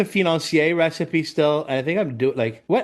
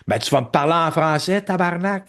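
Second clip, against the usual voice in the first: mock Québécois guest; 3 semitones higher than usual. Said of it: "And then they go".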